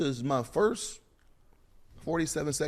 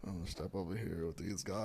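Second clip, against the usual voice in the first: sheepish aside; 6 semitones lower, 15 dB quieter than usual. Retold or imitated. Sneaking away from the brawl.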